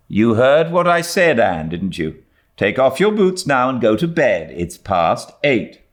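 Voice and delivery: firm, final; a hint of a guardian closing the matter; stern Marilla. A narrator's reading. Marilla ending it.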